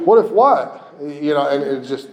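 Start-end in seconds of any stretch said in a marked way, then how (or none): 0.1-0.9 loud, high exasperated question; punchy mock-exasperated comeback; challenging retort. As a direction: throw it back loudly, pitch jumping. Challenges the fearful what-if.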